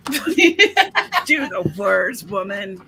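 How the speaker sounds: mock command. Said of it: "echoing the tough-love line".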